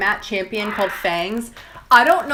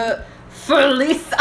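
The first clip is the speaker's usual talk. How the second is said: put-on drawn-out playful German accent; hammed-up German pronunciation; mock German accent; 3 semitones higher, slower than usual. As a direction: say it slowly with an exaggerated German accent. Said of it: "Heidi Klum in the building".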